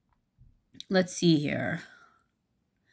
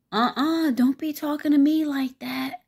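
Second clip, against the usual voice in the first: high, louder mock-threat from a domineering woman; threatening scold; 10 semitones higher, 4 dB louder than usual. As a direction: put her firmly in her place. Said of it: "the domineering one comes over".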